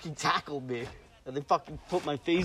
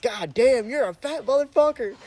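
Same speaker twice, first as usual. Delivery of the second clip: higher, louder retold outburst; mock outraged yell; 10 semitones higher, 8 dB louder than usual. Teen tackled by a cop, yelling in retelling. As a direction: blurt it out after a hard hit.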